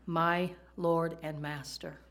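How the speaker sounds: my Lord and Master drawn out slowly; slow and deliberate. Shown as a demonstration. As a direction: savour each word of "my Lord and Master". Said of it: "it comes from the word Adon".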